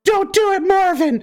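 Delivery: very high, squeaky plea; pleading gingerbread man. Acted. Gingerbread man begging his buddy Marvin, pleading. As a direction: cry out in a tiny cookie voice.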